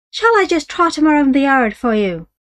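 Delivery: a wheedling Irish trader; Irish horse dealer. Acted. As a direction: sell the horse with easy Irish charm. Dealer presses his advantage.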